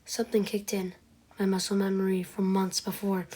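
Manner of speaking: ordinary talk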